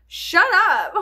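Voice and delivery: high, loud shout of shut up; mock yell at own brain. Yelling at her own thoughts, a mock loud shout. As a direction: snap at the chatter in her head. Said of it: "and I'm just like".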